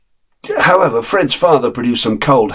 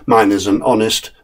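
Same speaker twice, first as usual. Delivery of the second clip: plain reading voice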